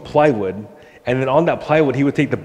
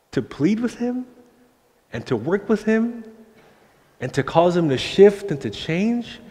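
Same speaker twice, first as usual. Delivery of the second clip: awed preaching; 6 semitones higher than usual. Real, not acted. Marvelling preaching.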